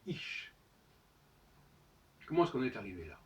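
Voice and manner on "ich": ich with a soft German ch. Shown as a demonstration. Teacher showing the German word, ich with a soft ch. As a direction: say ich the German way, hissed ch at the end. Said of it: "en allemand, on dit".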